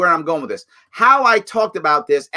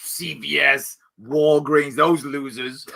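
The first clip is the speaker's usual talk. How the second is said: mock sneer; 5 semitones lower, slower than usual. Mocking the opponent's disdain.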